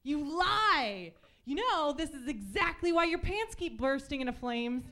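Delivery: accusing a friend loudly; accusing rant. Retold or imitated. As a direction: call him a liar to his face. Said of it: "I was like".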